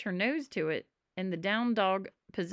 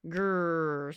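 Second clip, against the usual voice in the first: sleepy growl. Acted. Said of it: "said Calvin".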